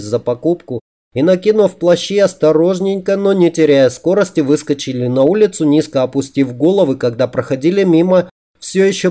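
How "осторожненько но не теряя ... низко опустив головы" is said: ordinary delivery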